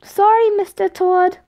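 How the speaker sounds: put-on high childish apology; giggly kid. A narrator's reading. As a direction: apologise like a cheeky little boy. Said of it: "Tom giggled in response".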